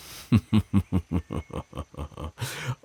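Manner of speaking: teasing predatory chuckle; a wolf amused by its prey; sly chuckle. Acted. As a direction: laugh as if the joke is on her. After threatening to make mate.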